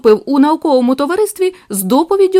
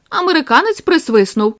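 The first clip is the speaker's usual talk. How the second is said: usual speaking voice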